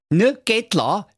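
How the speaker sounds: put-on high cheeky young lad; Schnitzelschorsch voice. A narrator's reading. Narrator voicing Schnitzelschorsch, a cheeky high voice. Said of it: "sprach der Schnitzelschorsch".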